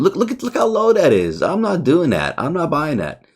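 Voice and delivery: rising in outraged excitement; excited disbelief. Real, not acted. Reacting to low candle.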